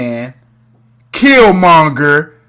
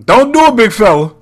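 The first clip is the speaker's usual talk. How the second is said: higher and louder playful call; mock warning; 6 semitones higher, 4 dB louder than usual. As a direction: shout a warning at the screen. Echoing a warning at a character.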